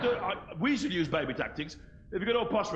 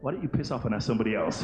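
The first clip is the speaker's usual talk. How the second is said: ordinary delivery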